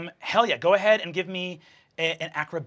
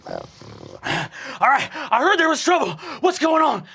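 high, loud, urgent character voice; big booming entrance, pitched way up; rallying shout; 10 semitones higher, 4 dB louder than usual. Acted. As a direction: burst in shouting to take charge. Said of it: "He goes".

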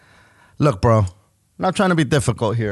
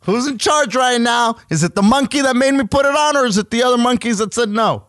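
mock bewildered customer; 10 semitones higher than usual. Mocking confused customer role.

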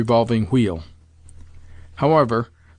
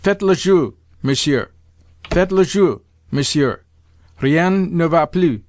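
higher, projected French announcement; raised ceremonial call; croupier proclaiming; 4 semitones higher, 3 dB louder than usual. A narrator's reading. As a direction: call the table to bet. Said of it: "proclaimed the croupier".

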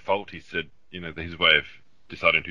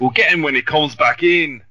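higher, louder botched Aussie accent; bad American Australian accent; 9 semitones higher, 8 dB louder than usual. Shown as a demonstration. Doing Point Break surfing scene on Bell's Beach, bad Australian accent.

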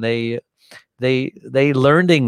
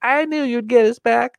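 put-on higher, admiring voice of the girlfriend; girlfriend's voice; 13 semitones higher than usual. Girlfriend praising the captain after the trip, higher admiring voice. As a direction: praise him like your hero. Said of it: "my girlfriend says".

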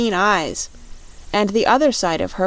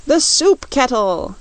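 drawn-out, higher yelled cry; storybook yell; 6 semitones higher, 4 dB louder, slower than usual. A narrator's reading. Scoodlers crowd round their soup kettle, a slow high yell. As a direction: call it out slowly like a hungry mob. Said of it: "yelled the Scoodlers".

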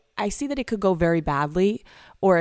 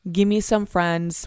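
normal talking voice

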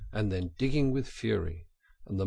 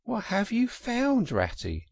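curious question; 12 semitones higher than usual. Acted. Mole calls to cheering Rat.

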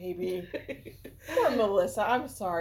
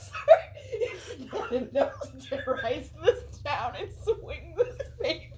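laughing; 9 semitones higher than usual. Real, not acted. Laughing fit while retelling.

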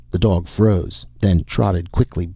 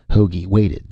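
ordinary delivery

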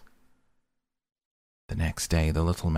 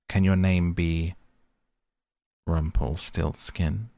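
slyly drawn-out guess, name stretched slow; knowing, sly question; 3 dB louder than usual. A narrator's reading. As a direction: tease him with the name, savouring it. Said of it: "said the lady slyly".

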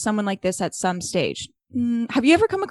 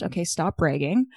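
usual speaking voice

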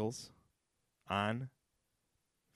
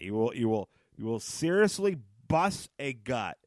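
ordinary talk

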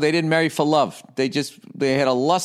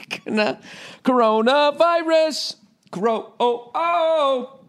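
mock hysterical squeal; 11 semitones higher, slower than usual. Mocking hysterical fans over corona.

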